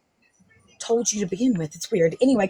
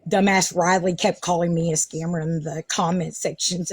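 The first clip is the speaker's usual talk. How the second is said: ordinary talk, nothing marked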